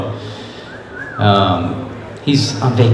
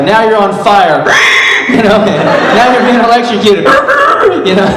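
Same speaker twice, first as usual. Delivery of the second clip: laughing; 10 semitones higher, 3 dB louder than usual. Retold or imitated. Quoting the director's cues, amused.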